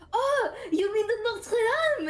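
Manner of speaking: Notre Dame in high exaggerated French; imitated French correction. French friend correcting the name, Notre Dame in exaggerated French. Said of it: "And she's like".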